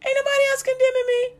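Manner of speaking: very high falsetto woman's reply; mock-feminine squeal, far above your voice; voicing the woman. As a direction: answer Jesus in a comic, piping woman voice. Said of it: "She like".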